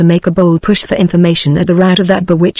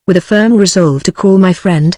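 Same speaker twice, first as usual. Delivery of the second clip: usual speaking voice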